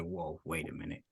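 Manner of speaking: usual speaking voice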